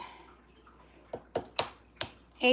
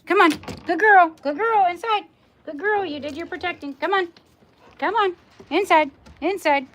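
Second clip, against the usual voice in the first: high, loud dog-praising voice; coaxing the dog; 7 semitones higher, 13 dB louder than usual. Real, not acted. Owner praising her dog for protecting, high loud coaxing.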